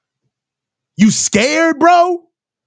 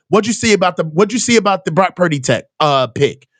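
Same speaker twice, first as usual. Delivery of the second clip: imitating reporter Grant; 8 semitones lower, faster than usual. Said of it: "All Grant said in there was".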